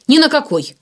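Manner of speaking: high, loud angry refusal; snapped retort. A narrator's reading. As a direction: snap back defiantly. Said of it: "огрызнулся Игнат". (огрызается, зло и громко)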